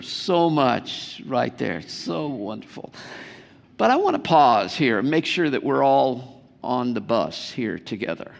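ordinary talk, nothing marked

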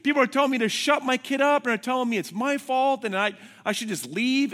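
high, loud, frantic phone complaint; retold upset wife. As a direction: imitate a wife pouring out her distress. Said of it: "She calls me, and she's like".

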